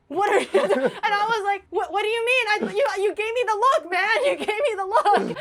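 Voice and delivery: half-laughing protest at a friend; laughing retort. Retold or imitated.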